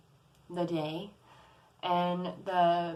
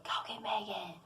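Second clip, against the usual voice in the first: hushed, eerie voice, much softer; creepy whisper; 8 dB quieter than usual. Retold or imitated. Spirit by the lamp telling a child to fetch help, creepy whisper. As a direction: whisper it like a ghostly command at someone's ear. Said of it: "but in a creepy whisper".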